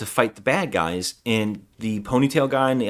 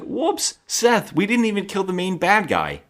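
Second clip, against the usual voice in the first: much higher, light mock dismay; oops; 9 semitones higher than usual. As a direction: play it like a sheepish oops. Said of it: "they're like".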